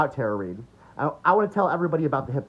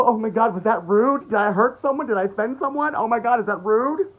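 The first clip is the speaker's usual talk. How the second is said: higher, fretful parody of a timid person; mock oversensitive worrier; 8 semitones higher, 4 dB louder than usual. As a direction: mimic someone anxious about offending. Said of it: "'Cause you're so".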